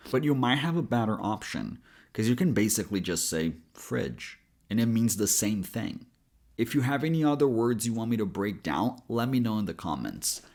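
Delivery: usual speaking voice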